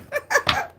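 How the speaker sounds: a quick, very high laugh. Real, not acted. Vlogger laughing after telling haters nothing scares her, high laugh. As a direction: toss off a high little laugh.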